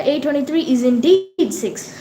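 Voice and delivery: normal talking voice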